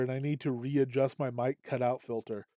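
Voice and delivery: ordinary talk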